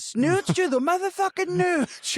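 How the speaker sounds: high, slow hammed-up yell; gleeful high mock holler; catchphrase shout. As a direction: belt it like a stoner hero. Quoting the character's catchphrase.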